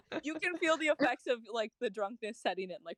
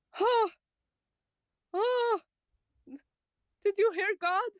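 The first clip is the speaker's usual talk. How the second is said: much higher, louder bewildered yokel; a high, louder squeak of baffled wonder; startled farmer; 9 semitones higher, 4 dB louder than usual. Acted. Startled farmer asks Rain about God's voice.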